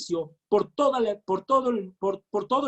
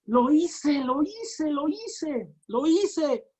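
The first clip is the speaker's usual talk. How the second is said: triumphant joy; 5 semitones higher, much slower than usual. Acted. Jesus voiced rejoicing inwardly.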